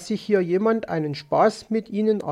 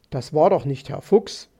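plain reading voice

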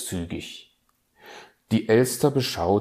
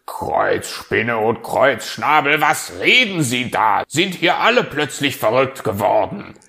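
louder, exasperated outburst; indignant fox; 4 semitones higher, 9 dB louder than usual. Acted. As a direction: blow up at the nonsense. Fuchs empört am Telefon. (empörter Fuchs, lauter)

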